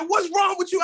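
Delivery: high, loud retold shout; hammed-up high shout; mock shout. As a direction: snap it back high and loud. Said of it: "She said".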